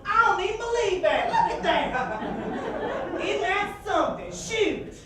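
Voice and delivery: high, slow, breathy-free gasping wonder in a put-on Southern lady voice; amazed country lady. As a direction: play a stunned small-town woman marveling at a trash can. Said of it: "all she can think to do is go".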